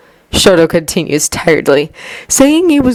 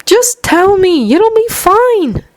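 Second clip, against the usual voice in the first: mildly exasperated; slower than usual. A narrator's reading. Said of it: "slightly exasperated".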